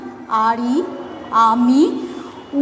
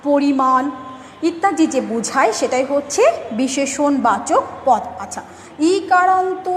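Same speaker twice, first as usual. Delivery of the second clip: normal talking voice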